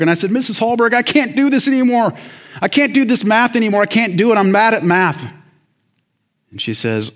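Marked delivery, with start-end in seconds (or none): 0.3-5.2 high, loud retold student's plea; desperate plea. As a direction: act out begging the teacher for relief. Acting his desperate plea to teacher.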